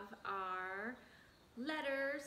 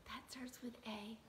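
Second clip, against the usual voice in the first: soft stage whisper; 3 semitones lower, 10 dB quieter than usual. Shown as a demonstration. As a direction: whisper it like a secret. Teacher whispers a secret.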